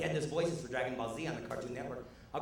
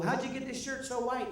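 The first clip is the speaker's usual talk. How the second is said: put-on high, teasing kid voice; sing-song taunt, pitched way up; mock schoolyard taunt; 11 semitones higher than usual. Schoolkids taunting the comic about his shirt, high mocking voice.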